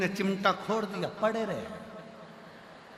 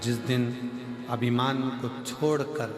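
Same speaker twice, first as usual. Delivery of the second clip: lower, steady preacher's cadence; sermon preaching; 5 semitones lower than usual. Acted. Preacher urges the congregation to drop their pride, sermon cadence.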